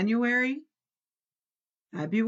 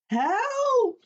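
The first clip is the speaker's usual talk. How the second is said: high, loud cry of dismay; cry for help; 5 dB louder than usual. A narrator's reading. Toad blinded by the sun crying out, high yelp. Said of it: "said Toad".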